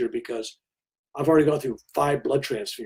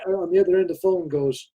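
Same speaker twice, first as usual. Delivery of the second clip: ordinary delivery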